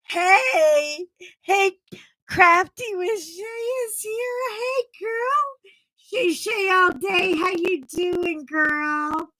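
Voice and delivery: light up at the friend's arrival; delighted high welcome to a viewer; excited greeting. Real, not acted. Greeting a friend joining live.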